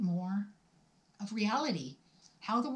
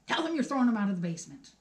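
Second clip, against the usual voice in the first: fast, laughing sign-off; 3 dB louder, much faster than usual. Real, not acted. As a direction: laugh it out quickly.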